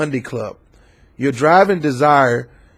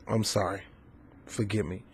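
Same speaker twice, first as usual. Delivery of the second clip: much softer, almost under the breath; quiet apology; 5 semitones lower, 18 dB quieter than usual. Real, not acted. Vlogger apologizing to viewers for insulting them, very soft. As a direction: take it back softly.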